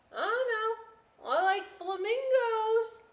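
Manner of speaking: dreamy little potato. Acted. Potato voiced.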